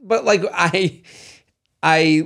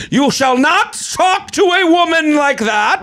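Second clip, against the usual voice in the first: mock heroic defender; 11 semitones higher than usual. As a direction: play the gallant knight shouting him down. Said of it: "you're not gonna go".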